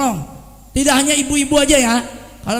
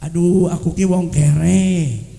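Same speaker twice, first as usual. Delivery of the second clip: slow, lower self-pitying moan; 6 semitones lower, 3 dB louder, much slower than usual. Retold or imitated. Friend complaining to the congregation that he is poor, slow moan. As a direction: sigh out your poverty like a victim. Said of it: "jangan suka gini".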